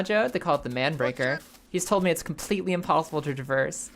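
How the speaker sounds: usual speaking voice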